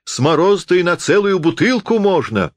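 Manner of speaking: high, hearty cheer of agreement; crowd's approval. A narrator's reading. The audience backs Napoleon's bottle, cheering agreement. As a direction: chime in eagerly, pitched well up. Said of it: "Так все и загудели".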